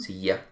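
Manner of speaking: silla as see-ah, no hard Y; Guatemalan silla. Shown as a demonstration. Teacher demonstrates Guatemalan speech, silla with I. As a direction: let the ll in silla melt into an I. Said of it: "en Guatemala generalmente se suele decir".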